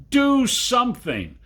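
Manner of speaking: high, loud urgent cry; panicked shout. Acted. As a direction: shout it desperately.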